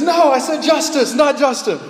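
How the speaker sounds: loud, high mock-indignant outburst; exasperated shout, imitating a misheard caller; indignant correction. Acted indignant correction of misheard word.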